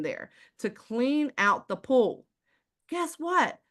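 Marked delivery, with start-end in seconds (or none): 2.9-3.6 rhetorical tease. Real, not acted. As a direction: Guess what pitched up high.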